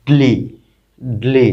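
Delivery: ড্লে blended tightly, sounding like dli; pronunciation demo. Shown as a demonstration. Teacher shows a different sound, pronunciation demo. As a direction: say ড্লে as one syllable, twice with a pause. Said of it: "এর উচ্চারণটা একটু different".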